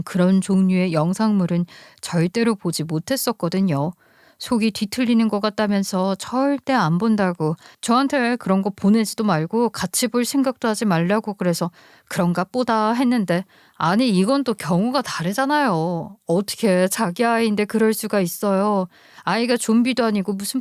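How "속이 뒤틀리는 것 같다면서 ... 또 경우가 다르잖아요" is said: ordinary delivery, nothing marked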